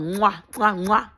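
kiss sounds. Acted.

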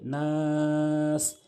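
নাস with the long a held for three counts; elongated vowel. Shown as a demonstration. Teacher holds the vowel of নাস long as a model. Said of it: "তিন আলিফ টেনে পড়তে হবে".

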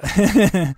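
sudden loud burst of laughter. Real, not acted. Laughs at own detour.

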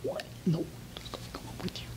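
quick, very soft whisper of disbelief; whispered incredulity. Acted. Boy looking at his father holding the rope, incredulous whisper. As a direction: whisper it as if stunned, barely audible. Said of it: "this look on his face of incredulity".

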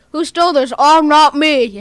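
loud, hammed-up denial; loud mock indignation; mock innocent thief. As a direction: play innocent at full volume. Acting out a looting scene.

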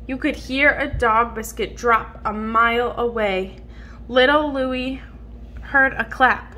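ordinary delivery, nothing marked

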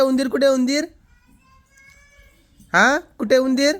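put-on high, loud sing-song coaxing for a toddler; baby talk. Father coaxing his toddler to say hi, high sing-song. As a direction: lift the voice high and call brightly to the little one.

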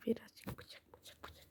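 unvoiced creepy whisper. Sneaking up on players, a menacing whisper. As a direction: breathe the words like a lurking killer.

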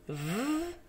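"v" held long with a voiced buzz; voiced sound. Shown as a demonstration. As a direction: hum through a long "v". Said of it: "et là, c'est la voix".

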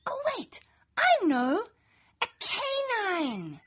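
sudden realization. A narrator's reading. Child finally finding the perfect pet, bursting with joy. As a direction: leap up with the answer, then savour it.

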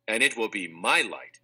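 plain reading voice

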